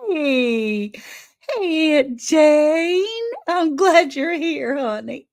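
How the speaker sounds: high, drawn-out happy hello; delighted greeting. Real, not acted. Host greets Aunt Jane joining the stream, delighted greeting. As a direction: greet a favorite aunt, loud and singsong.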